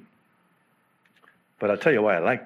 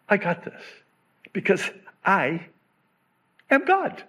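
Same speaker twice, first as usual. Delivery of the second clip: higher, soothing reassurance; God's voice, calming; 7 semitones higher than usual. Acted. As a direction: speak as God calming a child. Voicing God, paraphrase.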